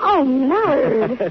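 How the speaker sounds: slow, drawn-out shocked cry of his name; ショックでゆっくり名前を呼ぶ; shaken. Acted. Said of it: "Don't jump like that".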